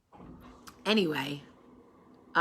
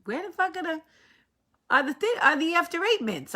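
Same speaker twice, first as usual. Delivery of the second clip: high-pitched mother muttering to herself while searching; imitating mother; 10 semitones higher than usual. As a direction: play mom hunting through the fridge, pitched way up. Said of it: "hearing my mother look in the refrigerator going".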